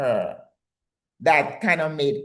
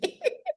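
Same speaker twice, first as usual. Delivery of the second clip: high laugh; 7 dB quieter than usual. Real, not acted. Laughs at her own joke.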